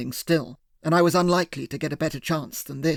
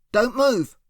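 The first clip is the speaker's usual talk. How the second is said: higher, sharp yelled warning; yelled command; 7 semitones higher, 4 dB louder than usual. A narrator's reading. Hero yells at the wounded kidnapper, a raised command. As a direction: shout it across the clearing. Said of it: "I yelled across at him".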